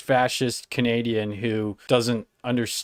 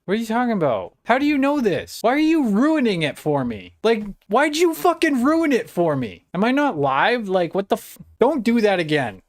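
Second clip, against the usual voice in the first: mock outrage; 9 semitones higher than usual. Real, not acted. Streamer complaining to chat about a spoiler, high mock outrage. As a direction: whine it, higher, in playful indignation.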